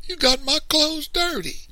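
loud, very high wail of outrage; wailing child. A narrator's reading. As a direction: wail it like an upset little boy.

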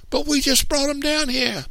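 put-on very high-pitched child's complaint; little boy protesting. A narrator's reading. Youngest brother protests being sent home, very high whine. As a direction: protest in a squeaky small-boy voice. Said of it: "little man protested".

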